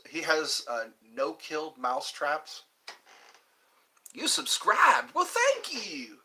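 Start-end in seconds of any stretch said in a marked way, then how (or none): 4.1-6.2 high, slow southern drawl thank you; high southern accent. Pretend. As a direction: put on a sweet southern accent. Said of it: "There's my southern accent".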